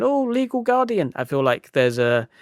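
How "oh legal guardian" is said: very high mock kiddie voice; little kid calling, pitched way up; four-year-old child. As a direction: squeak it like a small child. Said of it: "if you're a four-year-old and you're going".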